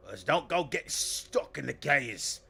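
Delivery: loud, high boss telling off the lot; barking orders. Acted. Boss to workers about the gears, loud scolding. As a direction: shout it down at your underlings.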